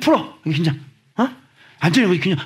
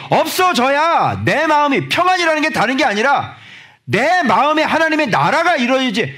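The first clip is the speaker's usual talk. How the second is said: higher, louder full-force sermon; fiery preaching; 12 semitones higher, 3 dB louder than usual. Acted. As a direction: preach it hard to the congregation.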